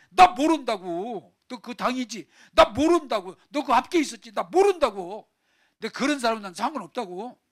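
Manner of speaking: loud, high, heated denial; 베드로. Acted. Peter denying Jesus to his accusers, heated shouting. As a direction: deny it angrily, getting worked up. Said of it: "막 열불 내다가".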